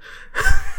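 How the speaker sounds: high sudden laugh; a sharp high giggle; burst of laughter. Real, not acted. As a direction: crack up at the joke. Laughs at Scottish accent joke.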